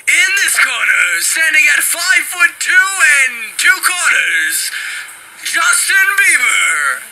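ring announcer. Pretend. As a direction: announce the fighter to the arena, stretch every word. Boxing match announcer intro.

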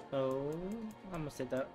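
ordinary talk, nothing marked

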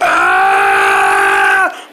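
long cartoon scream of panic; sudden terrified cartoon shriek; panicked scream. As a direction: scream like you've seen a monster. Cartoon characters panic at monster.

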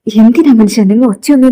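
loud exasperated reproach; exasperated scolding. Acted. Wife to husband about his shouting, exasperated. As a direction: scold him to quieten down.

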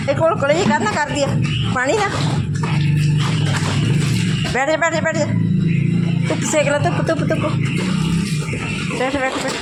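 high, sing-song talking to a dog; pet talk. Real, not acted. (ਕੁੱਤੇ ਨਾਲ ਉੱਚੀ ਲਾਡ ਭਰੀ ਆਵਾਜ਼)

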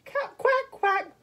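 high quacks; high-pitched duck call. Acted.

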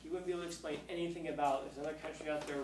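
usual speaking voice

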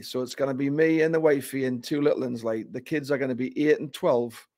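a light Geordie accent, quick at the start; a mild Geordie dad asking about a family tour; Newcastle accent. Shown as a demonstration. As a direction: put on a hint of Newcastle for the question. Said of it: "more of a, a Newcastle accent".

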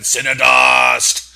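"Cinnadust" boomed out loud like a game-show reveal; hyped announcer shout. Shown as a demonstration. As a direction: bellow the brand name like an ad. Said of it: "'cause you have to say it like that".

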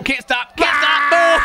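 mock panic shout. Reacting along with a runaway video, mock panic. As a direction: play at being out of control, voice high.

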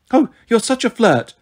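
high coy falsetto. Acted. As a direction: flutter it nervously. Grover in falsetto disguise.

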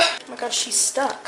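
ordinary talk, nothing marked

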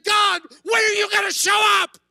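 high shouted plea to heaven; desperate cry to God. Acted. Voicing the world crying out.

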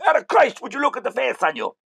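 high, loud exclamation; hammed-up cry at someone's expression; mock outburst. Fake cop drops his act to driver, high mock outburst.

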